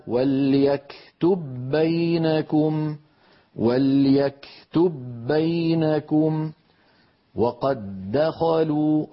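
slow, drawn-out chanted recitation; slow melodic Quran chant; Quranic recitation. Shown as a demonstration. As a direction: recite the verse slowly with tajweed.

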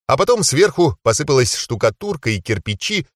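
usual speaking voice

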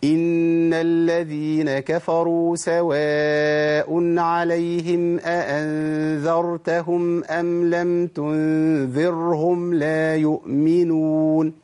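drawn-out recitation with a thick, dark ر in "تنذرهم"; wrongly heavy ر. Shown as a demonstration. Teacher demonstrating the second mistake, slow chant with heavy ر. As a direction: chant slowly, making the light ر of "تنذرهم" heavy. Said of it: "أنا فخمت الراء المرققة".

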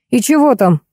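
usual speaking voice

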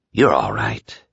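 low, soft reassurance; calm him down gently; quietly reassuring a scared friend; softly. A narrator's reading. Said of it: "Lloyd said softly".

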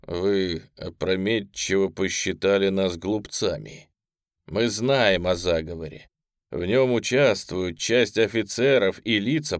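slow, measured, cold statement; slow and deliberate. Acted. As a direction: spell it out slowly and coldly. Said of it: "Он медленно сказал".

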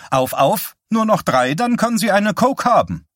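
high-pitched, sadistically playful urging; mock-sweet slave driver, pitched high; shrill. A narrator's reading. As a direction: goad the patient in a shrill voice. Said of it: "schriller, auf sadistische Weise humorvoller Stimme".

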